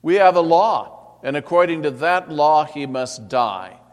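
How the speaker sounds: the crowd forcefully demanding; insisting. Acted. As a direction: press the point hard and loud.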